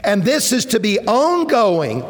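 preacher urging obedience and dependence, fervent; fervent preaching. Acted. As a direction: lift the room toward dependence on God. Preaching climax, quoted prayer.

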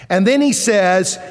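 ordinary delivery

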